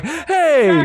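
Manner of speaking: a high, sudden shout of Hey; sharp call. Real, not acted. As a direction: call out to stop the play. Calling out at a distraction.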